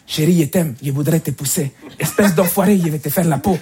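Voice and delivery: put-on monotone Spanish accent; love and threats in one same Spanish-accented tone. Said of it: "L'accent espagnol, par exemple, accent monotonalité". (accent espagnol monotone)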